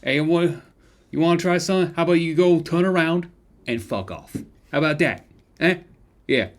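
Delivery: high, loud, mocking challenge; tough street thug. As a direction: square up and taunt them. Threatening NPC confrontation.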